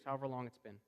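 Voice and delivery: usual speaking voice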